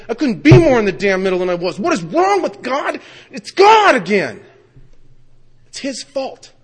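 loud, high shout of exasperation; angry outburst. Retold or imitated. Sober AA member blaming God, shouting in exasperation. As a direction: yell the blame out, pitch climbing.

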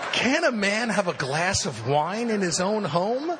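high, slow and mock-offended; hammed-up wounded pride; a slow mock protest; mock indignation.

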